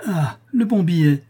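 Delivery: high, loud burst of mockery; exclaiming. A narrator's reading. Guest seeing the portrait, exclaiming in mockery. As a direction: cry it out, pitch jumping up. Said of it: "s'écria celui qui".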